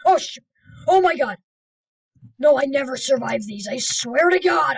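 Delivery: loud, high panicked cries. Real, not acted. Yelling in panic as the disaster strikes.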